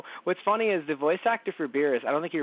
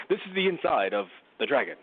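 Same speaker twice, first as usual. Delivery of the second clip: ordinary delivery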